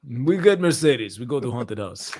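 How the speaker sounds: slow mock Russian accent; slow mock Russian mobster. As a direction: stretch the words like a mobster. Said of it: "We want Mercedes".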